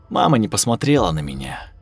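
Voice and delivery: usual speaking voice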